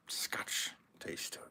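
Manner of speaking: soft breathy whisper, no voice; ASMR whisper. Pretend. Whispering to viewers about the whiskey's taste. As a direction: whisper it close like an ASMR video. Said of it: "A S M R".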